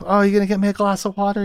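hammed-up high lady pleading with a silent clown; swooning lady voice. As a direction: gush like a lovesick society woman. Teasdale voiced in retold scene.

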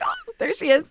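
very high-pitched fangirl coo; delighted recognition. Retold or imitated. Host re-enacting spotting the actress on screen, high and delighted. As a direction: squeak it out in delight.

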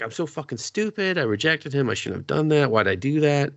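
lightly voiced regretful girl; voicing the heroine. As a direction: play her kicking herself over a mistake. Heroine regrets rejecting him.

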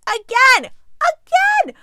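high, loud shout of disbelief; exasperated shout. Real, not acted. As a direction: yell it at the screen. Shocked outburst at movie twist.

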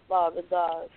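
normal talking voice

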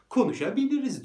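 mocking friendliness. A man joking with threatening dogs, high mock friendliness. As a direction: offers to talk as a joke. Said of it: "duruyorum ve dalga geçiyorum".

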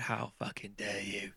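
low, hushed, slow indignation; mock affront. Answering a friend who insulted London, quietly affronted. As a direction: draw it out softly, as if gravely offended.

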